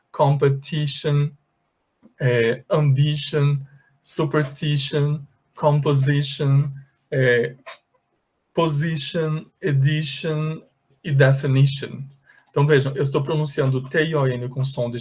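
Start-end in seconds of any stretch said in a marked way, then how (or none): 0.1-12.0 competition, ambition, superstition said slowly with a clear i; slow model reading. Shown as a demonstration. Teacher slowly reading the word list aloud. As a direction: read each word slowly, i as in ee. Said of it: "Vou repetir".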